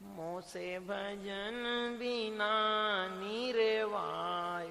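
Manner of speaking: soft slow sung-out quote; slow soft sung divine voice; voice of the Lord. Acted. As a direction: draw it out slowly, softly, as the Lord's reply. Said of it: "फिर उसने कहा उधर से".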